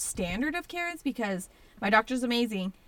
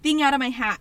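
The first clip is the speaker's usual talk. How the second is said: excited; 6 semitones higher, 9 dB louder than usual. Real, not acted. Excited retelling of pulling it out.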